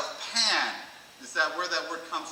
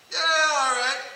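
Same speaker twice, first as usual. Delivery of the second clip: hyped-up self-talk; 8 semitones higher, 7 dB louder, slower than usual. Acted. Acted mirror pep talk.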